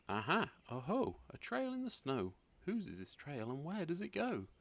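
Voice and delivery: put-on high, eager little child exclaiming; excited discovery. Gruffalo's child spotting a trail, excited high voice. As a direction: pipe up thrilled at the find.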